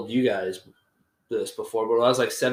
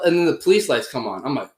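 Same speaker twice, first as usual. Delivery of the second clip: ordinary delivery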